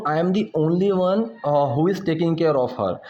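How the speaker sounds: slow, deliberate example sentence; slow teaching demo. Shown as a demonstration. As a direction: lay each word out slowly. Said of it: "अब मैं किसी को बोलूँ". (धीरे-धीरे समझाते हुए)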